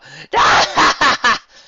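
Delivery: loud high guffaw. Acted. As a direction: burst out laughing. Guffaws after the joke.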